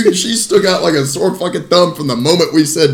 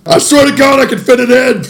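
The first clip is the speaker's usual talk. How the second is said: high, loud mock protest; hammed-up frantic plea; 8 semitones higher, 4 dB louder than usual. Joking about stretching the mouth out, hammed-up frantic plea. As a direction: push it high and strained for comic effect.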